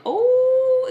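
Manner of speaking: high, loud drawn-out "ooh"; knowing "oh". Retold or imitated. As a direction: imitate brothers sensing trouble, high and long. Brothers sensing a fight coming.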